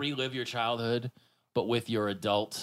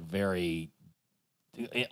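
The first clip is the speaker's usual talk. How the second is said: ordinary delivery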